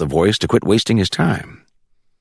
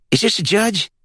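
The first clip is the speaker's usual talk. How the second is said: put-on country accent from the Glades. A narrator's reading. Caller on speakerphone checking for the judge, high country accent. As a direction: ask it in a high rural Florida accent. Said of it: "With that country accent you heard in the Glades".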